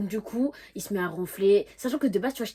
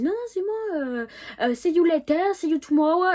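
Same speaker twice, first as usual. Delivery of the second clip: high, loud, stretched-out send-off; mock refusal; 9 semitones higher, 7 dB louder than usual. Elle rejoue son refus. (refus exagéré, voix haut perchée)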